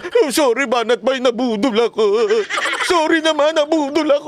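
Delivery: high, mock-sheepish whiny apology with a laugh. Mocking former critics who now admit being fooled, high whiny apology. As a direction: ham up a fooled supporter begging forgiveness. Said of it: "maniniwala na kayo na nabudol".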